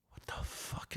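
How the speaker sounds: hushed unvoiced whisper to himself; whispered aside. Muttering to himself about the camera guy, in a whisper. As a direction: breathe it out under your breath, unheard by the crew. Retold inner thought during shoot.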